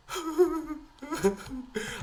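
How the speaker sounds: high laughing sob; crying laugh. Retold or imitated. Student laugh-crying after scraping the car. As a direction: break into a helpless laugh-cry.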